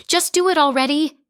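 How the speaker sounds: Mother screaming at the doctor to act; shrill furious order. A narrator's reading. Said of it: "Mother screamed at Doctor Petrosky".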